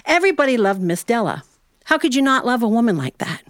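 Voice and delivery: plain reading voice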